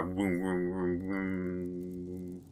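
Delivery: low, softening hum noise; imitated vroom. Player voices his zone of terror, low vrooming.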